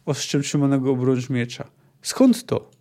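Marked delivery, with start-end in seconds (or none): 2.0-2.7 high, alarmed cry; raised, frantic question; roared. A narrator's reading. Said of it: "zaryczał Borazmun".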